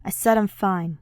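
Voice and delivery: firm, irritated repeat; insisting. Acted. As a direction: push back, stressing said. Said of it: "he insists".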